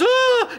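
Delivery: high startled mock yelp; a short fake shriek; mock scream. His old mate fleeing the helicopter, high mock scream. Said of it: "he goes".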